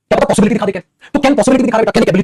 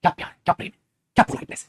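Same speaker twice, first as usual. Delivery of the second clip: breathless whispered wonder; hushed admiring whisper; whispered awe; 2 semitones lower, 7 dB quieter than usual. Retold or imitated. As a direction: whisper it, swooning at the politeness. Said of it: "वाह".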